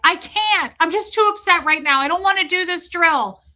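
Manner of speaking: high, loud, acted-out distress; loud, upset protest to the auditor; upset bawling. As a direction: plead at the top of your range, overwhelmed. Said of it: "and I'm bawling".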